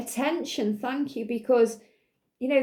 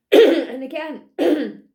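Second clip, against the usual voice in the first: clearing a scratchy throat; coughing between words; 2 semitones higher, 8 dB louder, slower than usual. Real, not acted. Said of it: "I'm sorry I'm coughing".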